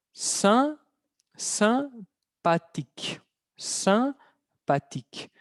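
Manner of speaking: "sympathique" slowly in pieces, nasal first syllable; drawn-out model of "sympathique", final E mute; silent final E. Shown as a demonstration. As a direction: split "Sympathique" after a high first syllable, end on K. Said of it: "La E es muda al final".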